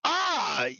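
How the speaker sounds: short high scream to check the mic; test scream. Shown as a demonstration. Streamer testing the mic with a high scream. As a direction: let out a quick high scream. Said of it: "Me testing out my mic when I scream".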